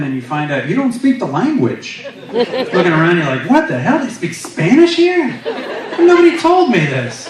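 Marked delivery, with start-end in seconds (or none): 3.5-7.3 high-pitched, baffled outburst; indignant disbelief. Acted. New arrival finding heaven speaks Spanish, high-pitched disbelief. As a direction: protest in high, incredulous surprise.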